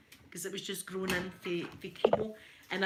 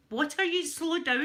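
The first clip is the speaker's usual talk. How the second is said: higher, louder mock bafflement; baffled protest; 7 semitones higher, 5 dB louder than usual. Japanese colleagues baffled by fast Scottish talk, baffled protest. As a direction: pitch up, exasperated imitation.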